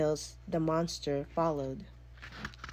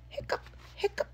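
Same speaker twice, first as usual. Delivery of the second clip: very high whispered hiccups; 5 dB quieter than usual. A narrator's reading. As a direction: squeak out the hiccups. Monster hiccups.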